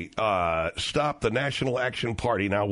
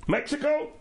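mock questioning; 12 semitones higher than usual. Mock guess at rival.

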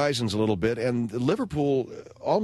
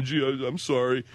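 sheepish apology. Retold or imitated. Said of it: "they're all, like, sorry and stuff".

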